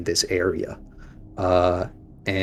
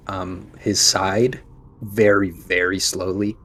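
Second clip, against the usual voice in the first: ordinary talk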